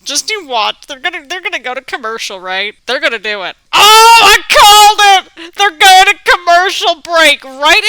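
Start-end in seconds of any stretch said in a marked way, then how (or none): 3.7-7.8 loud, slow, high-pitched gloating; triumphant shout. Real, not acted. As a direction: crow that you called it. Calling out commercial break excitedly.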